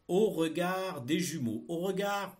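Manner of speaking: slow, loud and distinct dictation; dictating. Shown as a demonstration. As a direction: read each word out for pupils to copy. Teacher dictating text to pupils.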